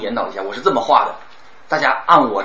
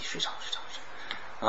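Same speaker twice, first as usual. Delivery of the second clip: late-night lullaby voice; 14 dB quieter, faster than usual. Shown as a demonstration. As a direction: lull the listener to sleep. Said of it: "我会把我的声音拉长".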